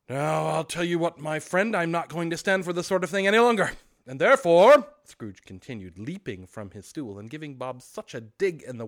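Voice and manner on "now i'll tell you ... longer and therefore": loud, rising pretend scolding; mock tirade. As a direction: build a fake rage toward the punchline.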